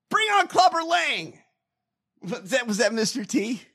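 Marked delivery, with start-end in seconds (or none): 0.1-1.4 high, loud challenger's shout; Rocky. Acted. Host as a movie boxer calling out a rival, shouting. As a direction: call out the next opponent at full voice. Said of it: "says Rocky".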